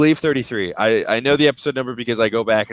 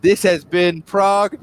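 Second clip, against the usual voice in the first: shouted sign-off; 9 semitones higher, slower than usual. Pretend. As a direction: yell the show's goodbye. Outro sign-off joke.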